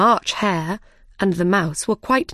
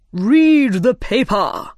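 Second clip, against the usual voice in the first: loud, slow royal order; commanding King; 4 semitones higher, 6 dB louder, slower than usual. A narrator's reading. King ordering the Rabbit to begin the trial, commanding. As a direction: draw the command out grandly, higher and louder.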